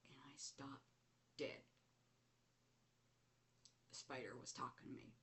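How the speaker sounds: ordinary delivery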